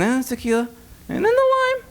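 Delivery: high squeaky tipsy sing-song; drunken squeal. Retold or imitated. Drunk guy reciting tequila shot steps, squeaking high.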